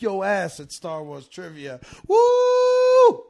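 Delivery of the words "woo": high, loud celebratory whoop; excited whoop. Real, not acted. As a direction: let out a big party cheer. Cheering at end of promo.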